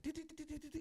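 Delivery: high rapid little beeps; imitated message alerts. Phone messages arriving at the border, high imitated beeping. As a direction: beep like a phone flooding with texts.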